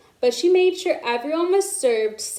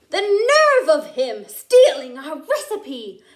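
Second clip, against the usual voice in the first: slow, louder outrage; indignant; 4 semitones higher, 5 dB louder than usual. A narrator's reading. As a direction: spit out the indignation. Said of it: "with fire in her eyes".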